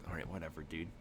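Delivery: low, quiet shrug of a reply; softly unbothered; dismissive. Acted. Shrugging reply of silent companion.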